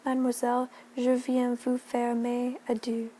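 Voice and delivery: put-on French accent. Miss Sharp bids the headmistress farewell in French, lightly accented. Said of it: "with a perfect accent".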